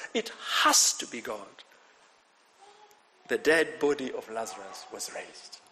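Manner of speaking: ordinary talk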